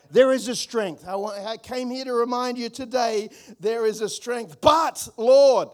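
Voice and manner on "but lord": loud protesting "Lord"; pleading cry. Pretend. Believer protesting to God, loud plea. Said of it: "this is what you're saying".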